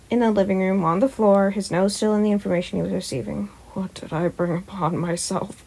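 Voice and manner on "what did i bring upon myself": soft, tired mutter under the breath; hushed groan of regret; weary whisper. Acted. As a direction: mutter it to yourself, exasperated. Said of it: "Aizawa whispered".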